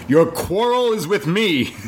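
high, slow, hammed-up hero line; mock-heroic. Hero confronting the villain at his party, mock-heroic. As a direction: declare it grandly, drawn out. Mock-grand quote of Bruce, hosts laugh.

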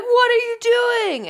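high, slow, stretched-out outcry; mock panicked cry. Imitating onlookers as he throws the phone, mock panic. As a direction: wail it in hammed-up disbelief.

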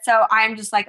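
usual speaking voice

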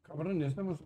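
talking with a mouth full; words round a bite of food. Real, not acted. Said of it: "me agarran con la boca llena".

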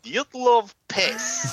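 high, slow hammed-up Russian accent. Shown as a demonstration. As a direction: stretch the name out in a thick Russian accent. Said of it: "let's hear your Dyatlov in Russian".

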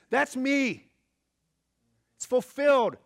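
loud, high proclamation; bold declaration. Acted. Jesus proclaims himself to the synagogue, loud and bold. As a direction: announce it with full conviction. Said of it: "Jesus sits down and says".

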